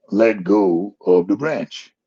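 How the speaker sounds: slow, deliberate divine command; slow God voice. Retold or imitated. God telling Jim on the cliff to let go, slow and deliberate. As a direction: say it slowly like God.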